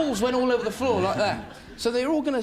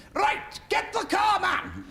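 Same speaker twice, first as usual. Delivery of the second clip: much higher, slow yelled order; mock Welsh shout; 10 semitones higher, much slower than usual. Welsh blokes shouting to grab the car, mock yell. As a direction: bellow it like a rowdy Welsh bloke. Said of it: "Welsh blokes in South Wales going".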